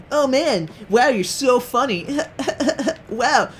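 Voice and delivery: high-pitched gushing with fake laughter; mock a fawning hanger-on, voice pushed way up; fawning beta orbiters. Teammates orbiting the girl in game, high mock fawning. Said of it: "Everybody becomes a beta orbiter".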